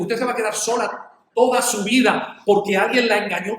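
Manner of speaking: retold emphatic scolding; indignant retort. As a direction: challenge her forcefully, as if appalled. Retelling his indignant reply to her.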